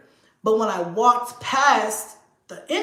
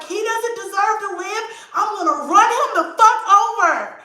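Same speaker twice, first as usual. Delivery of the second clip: high-pitched mock-furious threat, building louder; retold rage; 11 semitones higher, 5 dB louder than usual. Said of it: "I was so mad".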